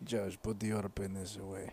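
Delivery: put-on low, soft Russian-accented order; mock Russian deadpan. Russian guy telling Josh off, in a low Russian accent. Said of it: "Russian guys telling another Russian guy".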